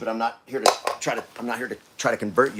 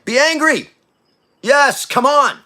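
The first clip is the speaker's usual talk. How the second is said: fiery preaching shout; 11 semitones higher, 9 dB louder, slower than usual. Real, not acted. Preacher urging congregation.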